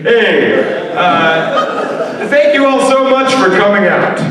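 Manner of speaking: high, bright counselor welcome; upbeat youth pastor. Pretend. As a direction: greet the crowd like a peppy camp leader. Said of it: "this is my impression of a youth pastor".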